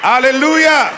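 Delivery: loud, high exultant cry; a triumphant shout of praise; shouted hallelujah. Real, not acted. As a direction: lift the church with it.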